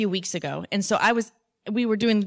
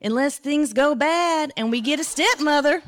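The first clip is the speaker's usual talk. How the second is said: high, loud Southern twang; imitated Southern kid chiming in; Southern accent; 7 semitones higher, 5 dB louder than usual. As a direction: play the twin in a pitched-up Southern voice. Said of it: "in his Southern accent".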